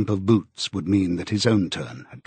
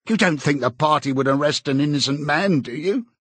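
higher-pitched, ingratiating appeal; servile, anxious Parsons; 5 semitones higher than usual. Acted. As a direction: plead for agreement in a raised voice.

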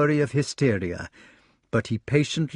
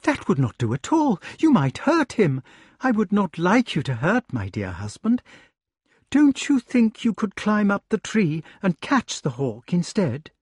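lady's request; 8 semitones higher than usual. Acted. Lady voiced in read story.

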